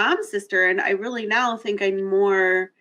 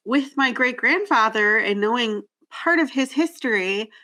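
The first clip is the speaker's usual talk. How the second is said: normal talking voice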